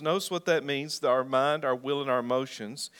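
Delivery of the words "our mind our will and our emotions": ordinary talk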